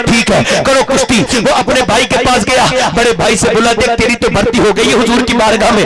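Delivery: steady, projected preaching on a level pitch; insistent near-monotone sermon narration; impassioned sermon storytelling. Real, not acted. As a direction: tell the story to a big crowd, driving on phrase by phrase.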